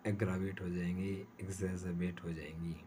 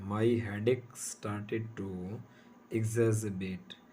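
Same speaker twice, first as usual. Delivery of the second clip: very slow, word by word; slow dictation pace; 4 dB louder, much slower than usual. Shown as a demonstration. Teacher reading the example sentence slowly to students. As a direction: read it out slowly for students to note. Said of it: "एक example और है".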